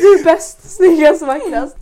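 high, loud gushing, slowing on the last words; fangirl praise. Retold or imitated. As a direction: heap compliments like a dazzled fan.

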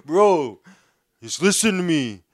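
put-on a drunk friend insisting on apologizing, loudly; loud pleading. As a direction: beg over and over, drunk.

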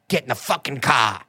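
put-on low Boston accent, mild first try; half-hearted Boston accent. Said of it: "original version of that is the Boston".

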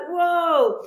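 startled whoa. Retold or imitated. Recalling first bobsleigh run.

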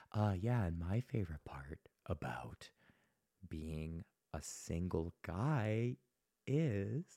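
soft, slow hushed delivery; talking quietly. Shown as a demonstration. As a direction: draw the room in by going very quiet. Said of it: "you start talking quieter".

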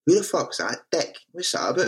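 mock indignant questioning; mock disdain. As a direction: imitate a baffled, dismissive punter. Voices a hostile audience member.

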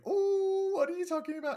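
very high, loud nosy coo; Trelane. Retold or imitated. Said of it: "creeping over, being like".

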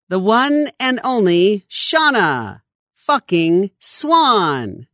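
big, drawn-out stage introduction, high and slow; loudspeaker announcer. A narrator's reading. Announcer hyping a rock-concert crowd before the lecture, big drawn-out introduction. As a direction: announce the star with a loud, lingering build to the name. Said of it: "a voice over the loudspeaker announced".

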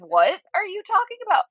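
high-pitched mock exasperation; exasperated disbelief. Acting out exasperated food worker reaction.

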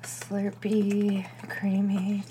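breathy teasing whisper; mock whispered slurp talk. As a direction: whisper it like a savored treat. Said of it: "They were like".

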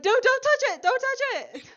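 very high, loud panicked yell; frantic shout. Retold or imitated. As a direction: scream a warning at the crowd. Agents warning crowd in station.

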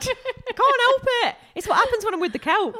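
high, loud holler through laughter; deafening mock yell. Host excusing her noise to the co-host, deafening yell. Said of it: "Sorry if you're deaf now".

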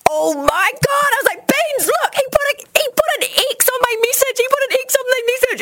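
very high, breathless-with-excitement retelling; thrilled squeal. Retold or imitated. Showing a friend her crush's message, high and giddily excited. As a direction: act out giddy overexcitement at a crush.

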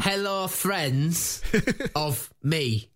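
slow, high comic voice; silly put-on greeting. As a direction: drag out a goofy hello.

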